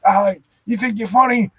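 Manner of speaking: high-pitched mock police aggression; hammed-up cop confrontation; imitated angry cop. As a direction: put on an irate officer pushing someone around. Retold cop confronting him.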